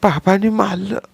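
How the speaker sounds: put-on crying child. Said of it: "Sunil रडत रडतच म्हणताच".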